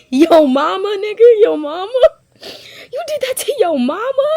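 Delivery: high-pitched, disbelieving rebuke; high, rising cries of disbelief; incredulous outcry. Real, not acted. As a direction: call out the character in shock. Scolding a book character, incredulous.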